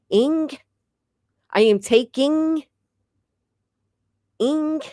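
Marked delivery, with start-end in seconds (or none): 0.1-0.5 "ing" said alone, high and drawn out; sounding out ing. Shown as a demonstration. Vlogger drilling herself on ing, sounding it out. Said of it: "I have to say ing". 4.4-4.8 "ing" said alone, high and drawn out; sounding out ing. Shown as a demonstration. Vlogger drilling herself on ing, sounding it out. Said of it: "I have to say ing".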